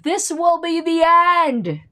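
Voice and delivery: high, loud, slow prophet-of-doom wail; hammed-up end-of-the-world cry; mock doomsayer announcing the end, slow and high. Quoting doomsaying libertarians.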